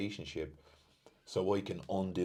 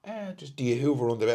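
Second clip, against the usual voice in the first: higher, quick nosy question; light mock partner; 7 semitones higher, 6 dB louder, faster than usual. Retelling partner asking about hoovering, higher and quick. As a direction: lightly imitate her probing. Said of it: "partner's Hoover question retold".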